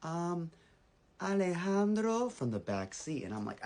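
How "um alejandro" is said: put-on high, drawn-out little-boy voice; little brother. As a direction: imitate an eight-year-old calling out slowly. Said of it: "eight years old".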